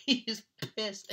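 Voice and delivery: high, loud words breaking out of laughter; tickled, barely getting it out through laughs; laughing. Real, not acted. Storyteller showing friend's angry look, laughing.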